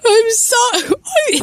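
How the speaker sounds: very high, drawn-out mock sob; hammed-up breaking crying voice; voice cracking. A narrator's reading. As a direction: squeak out a tearful apology slowly. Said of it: "his voice cracked".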